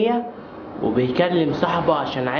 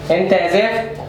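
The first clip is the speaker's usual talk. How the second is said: loud call out; 5 dB louder than usual. Acted. Mother shouting for her son, loud and impatient. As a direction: bark his name across the house.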